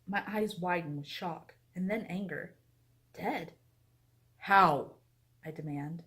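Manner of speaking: narrating a YA book passage; story reading. Acted. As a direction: read the excerpt like a novel narrator. Reads a book excerpt aloud.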